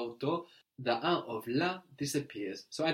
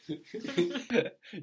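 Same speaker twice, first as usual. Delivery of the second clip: laugh; 10 semitones higher than usual. Real, not acted. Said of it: "You like that?".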